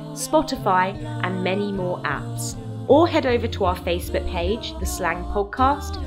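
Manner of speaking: usual speaking voice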